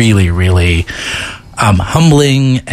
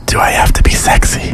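breathy, whispered sexy voice; mock-seductive whisper. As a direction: put on a husky whisper, playfully seductive. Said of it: "can do that sexy voice".

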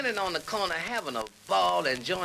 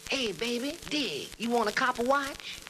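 higher, drawn-out hipster hustler voice; subway hustler character; 7 semitones higher than usual. Acted. Character on the subway selling a watch, hipster hustler. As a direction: pitch it up, slow and sly. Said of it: "a character came up to me something like this".

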